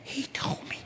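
"He told me" in a full breathy whisper. Retold or imitated. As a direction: whisper it like passing a secret. Gossip repeats in a whisper.

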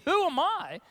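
indignant echo. Retold or imitated. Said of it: "you almost expect the response to be".

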